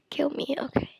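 ordinary delivery

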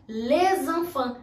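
"les" with open mouth, not rounded "le"; "les enfants" slow, open vowel; wrong open les. Shown as a demonstration. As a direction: contrast of les with le. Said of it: "A gente fala, por exemplo".

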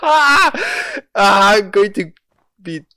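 loud, high wail of frustration; frustrated cry. Real, not acted.

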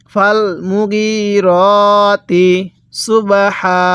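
মুগিরতি drawn out on long "ee" and "o" vowels; slow Quran recitation. Shown as a demonstration. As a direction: chant it very slowly, holding each long vowel. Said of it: "তাহলে কিভাবে পড়ব?".

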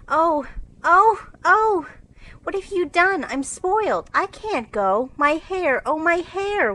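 high, crying wail of despair. Acted. Meg wailing at her scorched hair, crying. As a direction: sob over the ruined hair. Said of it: "wailed Meg".

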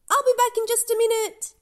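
high, fast and eager; eager girl. Acted. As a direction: rush it out, hurrying off. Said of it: "heads for the door".

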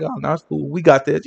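low, soft feigned cool; pretended calm. Preacher mimicking someone pretending nothing is wrong, cool. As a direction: play it down as if unworried. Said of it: "pretend like".

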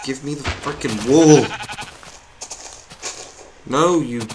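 loud shout. Real, not acted. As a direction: yell it at the sheep. Yelling at sheep.

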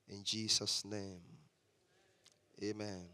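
low, very soft praying voice; hushed, low-pitched prayer; quiet prayer. Real, not acted. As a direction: pray gently under your breath, low in pitch. Closing opening prayer.

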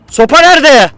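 loud, high-pitched yell; high shouted demand; shouting. Real, not acted. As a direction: holler it at full voice. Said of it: "neden bağırıyorsun?".

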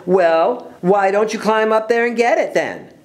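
high, goading challenge; taunting dare. Acted. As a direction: dare them like a schoolyard bully. Bully dares Ella to climb.

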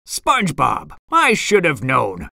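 ordinary delivery

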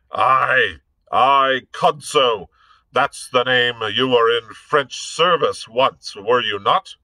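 bullying officer voice, loud and scornful; blustering captain. Acted. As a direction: bark it like a brutish dragoon. Hobart recognizing Blood.

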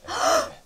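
high theatrical gasp; mock gasp. Teacher gasping at the late hour, hammed up. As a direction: gasp in staged alarm.

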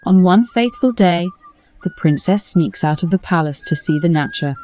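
ordinary delivery

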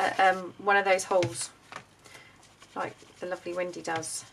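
normal talking voice

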